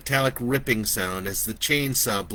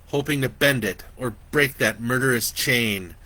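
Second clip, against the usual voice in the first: plain reading voice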